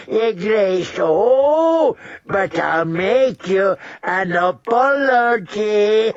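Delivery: high, slow, mocking old man voice; cackling old lord. Lord Walder mocking Robb, high cackling old voice. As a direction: sneer at the fallen king, gleeful. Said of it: "Lord Walder cackled at Robb".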